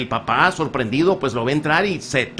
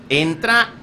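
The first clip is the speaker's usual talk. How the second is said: normal talking voice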